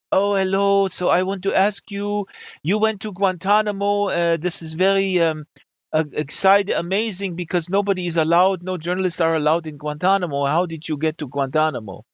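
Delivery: put-on French accent, lilting and curious. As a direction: imitate an eager French radio interviewer. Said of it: "I'm gonna do this with a French accent".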